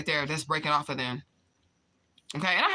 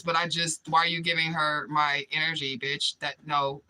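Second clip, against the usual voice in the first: ordinary talk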